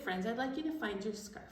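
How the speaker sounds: usual speaking voice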